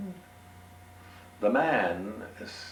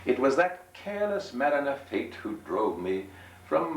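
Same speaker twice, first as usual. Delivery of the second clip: higher, dramatic gentleman voice; lament, pitched up; 7 semitones higher than usual. Acted. Gentleman tells his woes.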